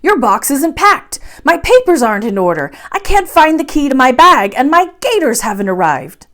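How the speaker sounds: loud, high, frantic list of complaints; a professor in a fluster, bawling orders; flustered shouting. A narrator's reading. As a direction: shout it all out in a rush of impatience. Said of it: "exclaimed my uncle".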